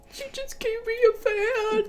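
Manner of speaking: hammed-up blubbering through laughter; imitating her sister crying on FaceTime, mock sobbing.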